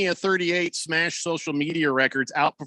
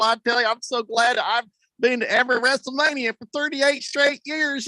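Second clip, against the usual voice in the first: much higher, awestruck true-believer fan voice; breathless wrestling superfan, pitched way up; gushing wrestling fan; 9 semitones higher than usual. Retold or imitated. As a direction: play the starry-eyed fan who thinks it's real. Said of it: "I guess the people are on the, uh, Twitter 'cause".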